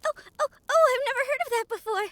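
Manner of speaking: loud, fast, high thrilled chatter; delighted by something new; breathless curious excitement; excited. Acted. Briar hears the word human.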